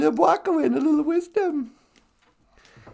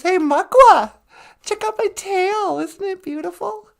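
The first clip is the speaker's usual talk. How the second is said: higher, loud show-off beaver; boastful beaver; 5 semitones higher, 6 dB louder than usual. Acted. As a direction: brag about the tail, preening. Boastful beaver shows off tail.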